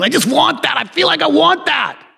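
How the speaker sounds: craving inner voice. Retold or imitated. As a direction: whine like you can't resist. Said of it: "Bam, you feel".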